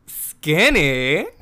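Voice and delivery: a gleeful, boosted "Skinny?" rising sharply; loud thrilled disbelief. Retold or imitated. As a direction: repeat "Skinny?" loudly, delighted. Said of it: "which I know is really deranged".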